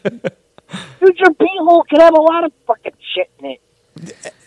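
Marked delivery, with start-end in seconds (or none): 1.2-4.3 high-pitched jab, leaning on "shit"; stress on SHIT. Real, not acted. Co-host ribbing friend about his beehole, stress on "shit". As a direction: hit the word "shit" harder than the rest. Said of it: "Emphasis on shit".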